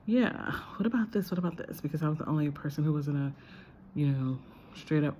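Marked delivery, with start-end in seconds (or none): 0.0-1.8 lightly acted, higher-pitched interjection; mildly pitched-up, imitating herself raising a point; questioning voice in discussion. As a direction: chime in with a tentative alternative. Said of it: "my voice was kind of this".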